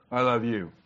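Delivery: "I love you" said offhand with no feeling; a flat careless "I love you" to his wife; wrong tone. Shown as a demonstration. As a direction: toss off "I love you" like it means nothing. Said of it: "is not the right tone of voice".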